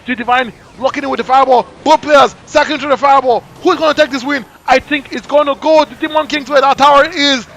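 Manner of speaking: loud, fast caster hype; live play-by-play. Real, not acted. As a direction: call the match live.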